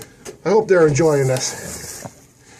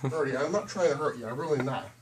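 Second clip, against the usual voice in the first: soft, gentle reassurance; soothing a bird; 5 semitones lower, 10 dB quieter than usual. Real, not acted. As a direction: talk softly to calm it.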